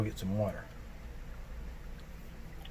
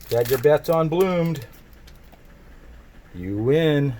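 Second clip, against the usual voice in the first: game show host; 3 semitones higher, 6 dB louder than usual. Pretend. Announcing the winners.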